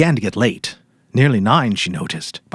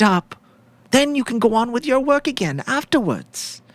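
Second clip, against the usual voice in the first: sweet widow's voice; 13 semitones higher than usual. Acted. Widow coaxing the policemen to eat the lamb, high and sugary. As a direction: play the grieving wife offering supper with false sweetness.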